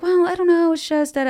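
high, loud flustered stammer. Retold or imitated. Answering her boyfriend who asks what she wants, high and flustered. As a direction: squeak it out, caught off guard.

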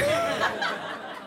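squealing high giggle; high laugh. Real, not acted. Laugh mid quote.

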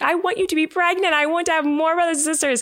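put-on high, pleading child voice; little kid. Young son's plea to mom, acted.

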